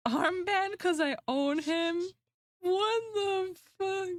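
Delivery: high incredulous questioning; appalled disbelief. Real, not acted. Streamer reading own old art note, appalled disbelief. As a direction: pitch climbing in amused horror.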